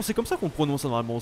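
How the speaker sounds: usual speaking voice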